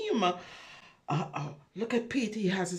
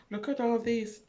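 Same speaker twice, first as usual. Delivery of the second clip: ordinary delivery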